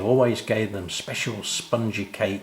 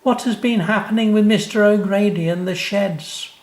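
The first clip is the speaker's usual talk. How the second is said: put-on amazed little boy; 12 semitones higher, 5 dB louder than usual. Sam tells Dad the hours flew, high and amazed. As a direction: marvel like a small boy.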